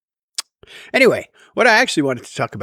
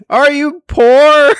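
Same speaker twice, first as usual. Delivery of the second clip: loud, high and slow mock blurt; 13 semitones higher, 9 dB louder, slower than usual. Blurting a tactless question at the pianist, loudly. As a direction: bellow the question across the room. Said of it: "in a really loud voice".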